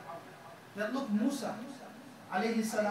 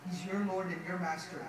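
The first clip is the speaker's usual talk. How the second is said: usual speaking voice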